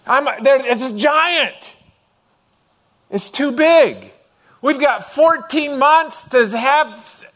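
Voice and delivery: high, loud, slow and dragging; overwhelmed worry. Retold or imitated. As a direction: sound swamped by the size of it. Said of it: "I was getting that way".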